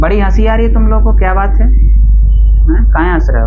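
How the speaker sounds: loud classroom challenge; challenging the gigglers. Real, not acted. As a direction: confront laughing students, then brush it off. Teacher challenges students laughing at his diagram.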